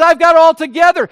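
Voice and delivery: loud and high, smug boast of the elder son; proud elder son. Retold or imitated. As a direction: brag like you've got it all figured out. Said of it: "He's proud".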